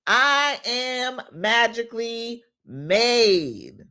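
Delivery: slow, emphatic affirmation chant; slow preacher-like affirmations; affirmation leading. Acted. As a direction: lead the congregation in declaring it, slowly. Leads affirmations for viewers to repeat.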